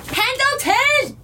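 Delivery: high calling shout. Acted. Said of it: "You shout Pendleton".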